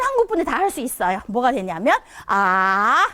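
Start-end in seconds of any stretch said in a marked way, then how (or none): 2.3-3.1 아 drawn out, dipping low then rising; third tone. Shown as a demonstration. Teacher models third tone, long dipping 아. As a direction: let 아 sink and come back up slowly. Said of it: "레, 도, 파 이렇게 가요".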